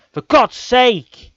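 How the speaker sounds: high, loud cry of exasperation; real anguished yell; frustrated shout. Real, not acted. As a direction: explode at the conceded goal. Losing badly, exasperated outburst.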